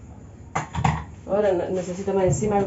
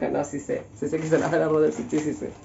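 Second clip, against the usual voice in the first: low, soft sheepish backpedal; 6 semitones lower, 6 dB quieter than usual. Real, not acted. Admitting to viewers she knows, soft sheepish mumble. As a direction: quietly take it back. Backtracking after joke.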